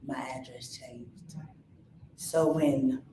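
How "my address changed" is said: whisper. Retold or imitated.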